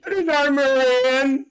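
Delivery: loud, high drawn-out holler with a laugh; excited holler. Real, not acted. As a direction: sing it out high and loud, stretching the words. Excitedly hails someone joining the trip.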